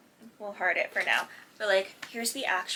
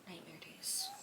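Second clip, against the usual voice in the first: sighed, whispered and much softer, slow; weary sigh; 16 dB quieter than usual. Real, not acted. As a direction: breathe it out like a bad memory. Sighing about old MapQuest days.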